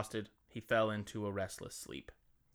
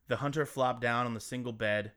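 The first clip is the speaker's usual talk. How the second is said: usual speaking voice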